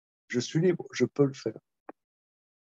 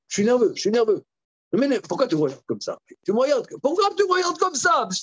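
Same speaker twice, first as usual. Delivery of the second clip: higher, louder, fast agitated complaint; nervous outburst; 10 semitones higher, 5 dB louder, much faster than usual. Retold or imitated. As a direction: fire it off like a touchy guy losing his temper.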